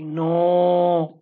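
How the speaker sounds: long, low no with a held vowel; drawn-out refusal. Shown as a demonstration. Teacher acts refusing "give me some money", long drawn-out no. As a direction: stretch no out like refusing someone money. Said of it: "It's exactly like that".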